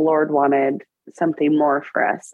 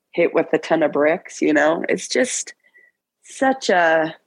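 ordinary delivery

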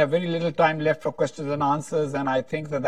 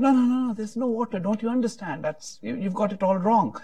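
higher-pitched, impatient no-no-no; exasperated objection; 8 semitones higher than usual. Retold or imitated. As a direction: push back at the silly answer, exasperated. Joke, friend objects in exasperation.

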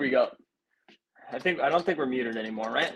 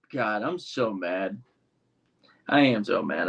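normal talking voice

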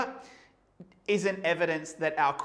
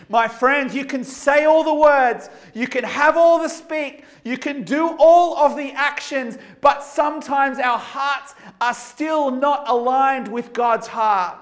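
higher and louder, building fervor; impassioned preaching; 7 semitones higher, 9 dB louder than usual. Acted. Preacher warning the congregation about hearts, impassioned. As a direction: press the point to the friends.

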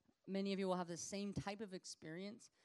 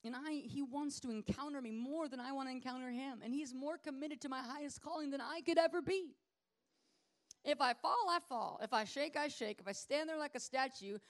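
confession of faith; 5 semitones higher than usual. Acted. You have to believe.

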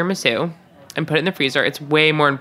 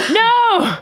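shocked cry; 5 dB louder than usual. Real, not acted. Shocked outcry after a cough.

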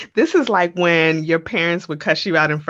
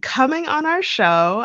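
high excited thanks; 9 semitones higher, 3 dB louder than usual. Real, not acted. Host thanks guest warmly.

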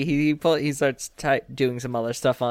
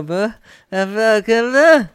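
put-on child babble; 8 semitones higher, 6 dB louder, slower than usual.

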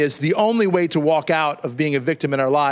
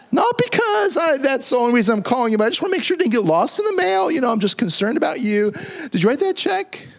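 sweet-talking nephew; 8 semitones higher than usual. Pretend. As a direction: fake sweetness to the rich aunt. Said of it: "act like you love Aunt, sweet Aunt Jane".

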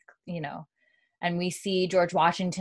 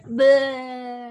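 disgusted bleh; 6 semitones higher, 4 dB louder than usual. Real, not acted. Disgust at hernia pictures.

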